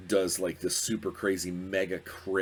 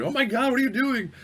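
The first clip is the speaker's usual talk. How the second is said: much higher and louder, a gleeful shriek; shocked excitement; 6 dB louder than usual. Retold or imitated. Players shrieking at him breaking open the canister, high and loud. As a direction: players losing it at a wild move. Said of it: "they're like".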